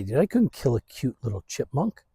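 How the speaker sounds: ordinary talk, nothing marked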